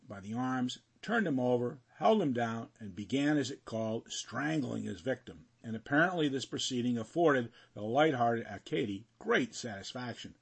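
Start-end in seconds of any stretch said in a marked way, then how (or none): none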